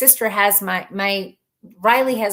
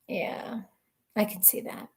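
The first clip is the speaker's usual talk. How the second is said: hushed, half-joking agreement; quiet unvoiced aside; whispered aside; 10 dB quieter than usual. Real, not acted. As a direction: whisper it to yourself.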